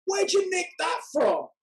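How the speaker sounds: high mock yell in a put-on London lad accent; shouted out. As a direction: bellow it up from the skate park like a cheeky teenager. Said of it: "shouted out".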